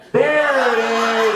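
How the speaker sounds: long, drawn-out shout; triumphant holler. Real, not acted. Comic hearing his friend answer, triumphant holler. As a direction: stretch the words in loud triumph.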